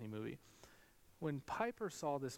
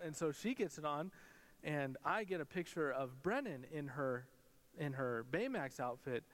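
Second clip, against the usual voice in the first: ordinary talk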